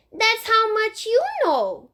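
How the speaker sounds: put-on a small child explaining matter-of-factly. Fudge in the tree explaining to Sheila, in a high little boy voice.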